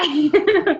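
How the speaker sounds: a choked little laugh through tears; half-laugh, half-sob; teary laugh. Real, not acted. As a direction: laugh it off while tearing up. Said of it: "you're making me cry".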